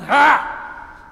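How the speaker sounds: loud, high mock "Ha!"; a grouchy scoff in the Walmart line; hammed-up annoyed scoff.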